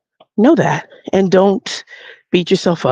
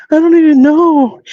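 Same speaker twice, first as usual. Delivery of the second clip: high, louder mock-distraught voice; upset young woman; 10 semitones higher, 5 dB louder than usual. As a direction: imitate a young woman wailing over a loss. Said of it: "so this is how she talks".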